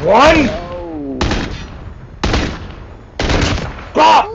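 crowing over a headshot; hype yell. Real, not acted. Hype after a kill.

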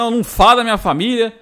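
imitating someone riled up and defensive; angry retort. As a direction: fire back indignantly, rushing the words. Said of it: "o Ed ficou puto".

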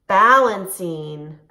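slow, loud call; announced call. Shown as a demonstration. Teacher announcing balancing, slow and loud. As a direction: stretch it out to start. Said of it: "Balance like a flamingo".

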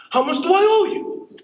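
squeaky high-pitched voice; high character voice. Retold or imitated. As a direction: pitch way up, surprised. Neighbor voiced in story.

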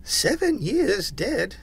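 slow, high-pitched pondering; musing. A narrator's reading. Scrooge to Jacob's ghost, musing. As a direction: wonder aloud in a high character voice. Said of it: "mused Scrooge".

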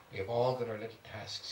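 plain reading voice

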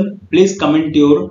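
ordinary talk

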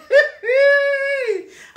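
high, loud laughing wail; high mocking laugh. Real, not acted. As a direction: crack up high and loud at her. Mocking a rival's reporting.

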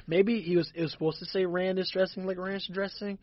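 ordinary delivery

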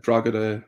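"Drogheda" with a hard G, DROG-uh-da; foreign mispronunciation. Shown as a demonstration. Television people from abroad naming the town, "Drogheda" with hard G. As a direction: say "Drogheda" in three syllables, sounding the G hard. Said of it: "television programs from abroad".

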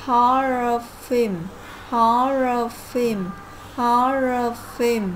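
slow, loud "horror film" with HOR stressed; slow classroom drill of "horror film"; stress first syllable. Shown as a demonstration. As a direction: draw out "horror film" for students to copy, leaning on HOR. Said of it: "horror, nhấn âm thứ nhất".

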